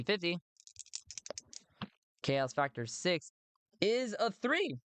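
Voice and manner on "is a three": high-pitched, escalating thrill; giddy outburst at a dice roll; real excitement. Real, not acted. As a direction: exclaim in rising disbelief, higher and higher. Reacts to a lucky dice roll.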